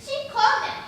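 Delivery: loud, high, scornful challenge; angry defiance. Acted. Dorothy defying her blackmailer, angry defiance. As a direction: throw the threat back in his face.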